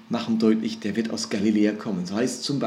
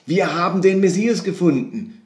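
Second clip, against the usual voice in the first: lifted, a little louder, eager announcement; excited; 6 semitones higher, 4 dB louder than usual. Retold or imitated. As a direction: share the big news with joy. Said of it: "von einer großen Begeisterung erfasst". (begeistert, etwas höher und lauter)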